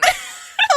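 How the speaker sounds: shrill burst of laughter; high shrieking laugh. Real, not acted. Reacting to awkward dream line.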